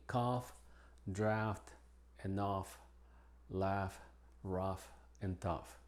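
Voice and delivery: "cough, draft, enough, laugh, rough, and tough" with an F sound; "cough, enough, laugh, rough, tough" each ending on F. Shown as a demonstration. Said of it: "la G H se pronuncia como F".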